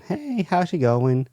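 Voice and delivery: high, drawn-out cashier hello; put-on customer-service hello; mock friendly greeting. Cashier greets customers in retold story.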